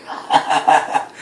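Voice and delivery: breathy mocking laugh back. Wrestler laughing back at his rival, breathy mock laugh. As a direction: laugh right back in his face, half-voiced. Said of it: "he laughs at him like".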